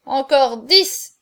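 slow, loud boast from a staggering drinker; reeling drunk's defiant answer; drunken bravado. A narrator's reading. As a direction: drag it out, loud and tipsy. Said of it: "répondit Chaverny, chancelant".

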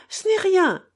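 the second try cracks upward with tears; crying harder. A narrator's reading. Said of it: "poursuivit-elle en pleurant".

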